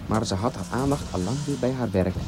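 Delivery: ordinary delivery, nothing marked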